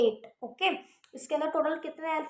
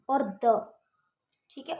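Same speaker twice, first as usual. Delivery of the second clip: ordinary delivery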